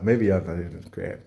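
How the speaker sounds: ordinary delivery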